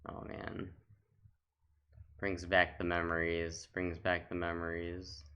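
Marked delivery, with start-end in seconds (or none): none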